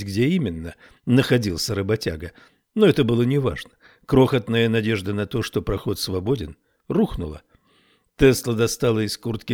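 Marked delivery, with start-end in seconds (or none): none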